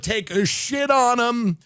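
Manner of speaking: ordinary talk, nothing marked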